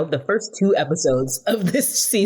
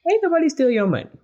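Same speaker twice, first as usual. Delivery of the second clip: imitating Tasha; 9 semitones higher, faster than usual. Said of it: "And Tasha is sitting on the couch".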